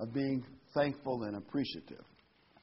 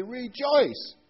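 "rejoice" rung out loud and much higher; emphatic REJOICE; 12 semitones higher, 8 dB louder than usual. Real, not acted. Preacher reading Philippians to the congregation, "rejoice" loudly emphasized. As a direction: proclaim "rejoice" with a big high lift, then a fall. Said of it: "I'm emphasizing the word rejoice".

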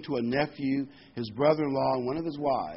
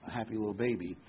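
ordinary talk

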